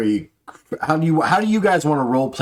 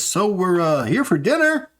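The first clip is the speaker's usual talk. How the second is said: higher voiced guest; lightly pitched up visitor; NPC voice; 8 semitones higher than usual. GM voicing NPC arriving.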